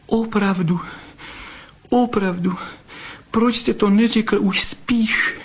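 very high, moved vixen voice; tearful blurting. A narrator's reading. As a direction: blurt it out, overcome by his confession. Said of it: "vyhrkla a utírala si čumáček". (dojaté vyhrknutí vysokým hlasem)

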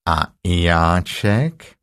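very slow, low interjection; low donkey drawl; gloomy Eeyore. Acted. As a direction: drag it out dolefully in a low voice.

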